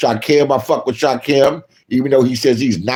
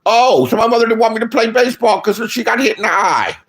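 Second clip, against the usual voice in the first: realizing; 7 semitones higher than usual. Real, not acted. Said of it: "realization exclaimed".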